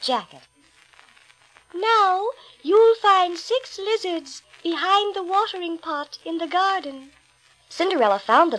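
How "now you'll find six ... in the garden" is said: high, slow gentle instruction; fairy godmother. A narrator's reading. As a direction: tell her kindly, slowly. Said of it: "the fairy godmother".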